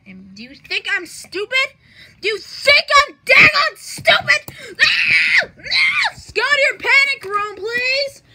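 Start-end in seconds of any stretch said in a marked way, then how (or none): 2.2-4.6 high, very loud outraged yell; hammed-up screaming rage; furious shout. Repeats question, blowing up in anger. 4.8-6.2 very high, loud "ah" shrieks; short piercing yells; raging screams. Pretend. Enraged screaming after the question. 6.3-8.2 high, loud, annoyed yell; hammed-up cranky yelling; exasperated shout. Orders other away, wants sleep.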